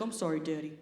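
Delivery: low, soft mock apology; meek new cook. New cook apologising to coworker, meek. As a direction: apologise small and low, imitating him. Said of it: "He's like".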